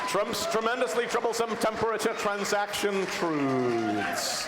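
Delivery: big prize-giving shout; winner announcement. Real, not acted.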